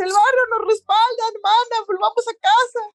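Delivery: high, earnest anime plea; girl begging her sister to come home; Sho pleading. Acted.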